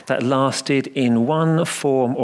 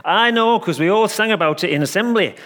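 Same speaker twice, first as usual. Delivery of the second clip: put-on triumphant little boy; 8 semitones higher than usual. A narrator's reading. Little boy proving it to the vicar, triumphant. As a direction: crow it like a know-all little lad. Boy's final triumphant claim.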